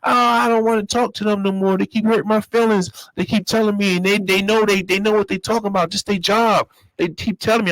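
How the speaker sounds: put-on upset girlfriend. Girlfriend refusing to call the prison, mock whiny. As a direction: imitate her complaining she won't call. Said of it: "That's what she's saying to me".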